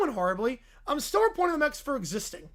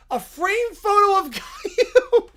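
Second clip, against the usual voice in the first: reading through real laughter; can barely get the words out for laughing; laughing while reading; 8 semitones higher, 9 dB louder than usual. Real, not acted. Cracks up reading Caillou photo.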